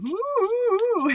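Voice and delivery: long, high, loud "woo"; excited whoop. Acted. Reader reacting to where that feeling comes from, excited whoop. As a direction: let out swooping high whoops.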